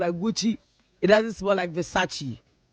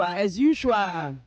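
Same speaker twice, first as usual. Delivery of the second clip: "as usual" stretched long and slow; slow drawn-out "as usual"; much slower than usual. Retold or imitated. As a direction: drag out "as usual" lazily. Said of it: "Obi will say".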